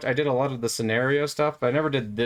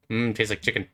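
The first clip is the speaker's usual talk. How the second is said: normal talking voice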